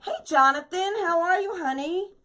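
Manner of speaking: high, loud delighted hello to a viewer; high happy greeting. Real, not acted.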